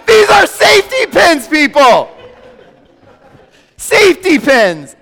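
high, loud disbelieving shout; exasperated shout. Real, not acted. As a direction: yell it at the crowd in mock outrage. Exasperated at the label.

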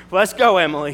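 sudden loud call, retold; loud, urgent re-enacted order to get moving; riled command. Retelling his own riled command.

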